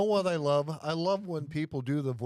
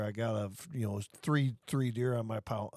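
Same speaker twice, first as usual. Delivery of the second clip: low, softer quoted hunter; casual hunter; 7 semitones lower, 4 dB quieter than usual. Retold or imitated. Imitating someone reporting deer to friends, low casual hunter. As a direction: lightly mimic a hunter, pitched down.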